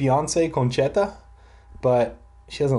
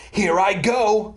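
higher, drawn-out race thought; eager thought; 6 semitones higher, slower than usual. A narrator's reading. Sammy to himself in the race, eager. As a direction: stretch it out with rising eagerness. Runner thinking mid-race in story.

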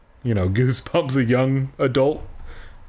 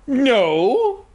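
high, drawn-out mock-innocent no; smirking denial; 11 semitones higher, 4 dB louder than usual. A narrator's reading. Gina denies entering the room, smug. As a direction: swoop up high on a coy denial. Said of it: "still smiling".

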